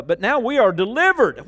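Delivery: loud, high proclamation, full force; preaching shout. Real, not acted. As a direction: shout the deliverance to the church. Preacher reading scripture loudly.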